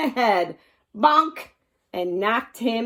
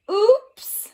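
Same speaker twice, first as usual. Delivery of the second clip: high, loud startled oops; the bat slips away; surprised oops; 11 semitones higher, 6 dB louder than usual. Acted. Bat flies from hands.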